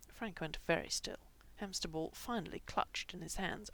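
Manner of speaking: ordinary delivery, nothing marked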